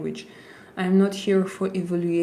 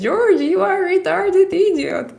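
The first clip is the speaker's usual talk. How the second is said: much higher and louder, a put-on squeaky insult; mock high voice; 11 semitones higher, 5 dB louder than usual. Shown as a demonstration. Streamer repeats the chat insult in a silly high voice. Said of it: "I'm going to say like this".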